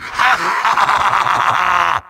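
long theatrical villain cackle; evil laugh. Acted. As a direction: cackle in gloating triumph. Villain gloating at the hero.

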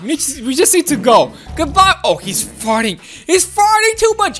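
ordinary talk

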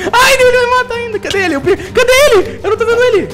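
excited shrieking. Real, not acted. Panicked hunting an enemy in-game.

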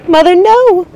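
very high, loud scream of protest. A narrator's reading. Primrose begging her mother to stop, screaming. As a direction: cry out in terror to stop her. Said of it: "Primrose screamed".

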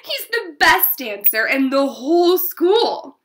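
loud, high, drawn-out gushing; excited squeal. A narrator's reading. Girl tells Mama about her dance partner, squealing. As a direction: gush slowly and loudly with girlish excitement. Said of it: "I squealed".

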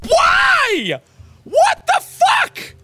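high, loud, slow shrieking; real outraged scream; furious scream. Real, not acted. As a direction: scream it at the screen. Screaming at the game.

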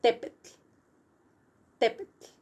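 "Tepetl" said alone, ending in voiceless tl; word-building demo. Shown as a demonstration. Teacher modelling the word that means mountain, isolated repetitions. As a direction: model "Tepetl" twice with a pause, no vowel after the t. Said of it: "the whole word that means mountain".

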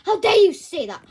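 loud, indignant demand; loud angry. Acted. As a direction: shout it in outrage. Line repeated loud then quiet.

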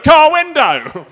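high, loud, drawn-out panicked shout; stressed-out yell. Acted. Stressed slider to waiting family, panicked yell. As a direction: blurt it out in comic panic. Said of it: "you're so stressed out".

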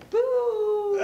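high, loud, long-held "boo"; drawn-out spooky hoot; ghostly boo. Acted. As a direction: wail it like a pretend ghost. Child playing ghost to scare girl.

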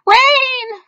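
shrill, very high and loud cry of alarm; panicked cry. Acted. Ants crying out as the rain starts, very high loud cry. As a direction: scream it as the storm breaks. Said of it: "cried the ants".

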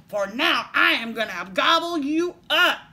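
troll voice. A narrator's reading. Troll threatening to gobble the little goat, bellowing. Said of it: "said the troll".

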